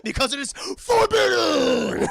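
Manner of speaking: drawn-out, high-pitched furious bellow; hammed-up roar, stretching the last word; roaring Beast.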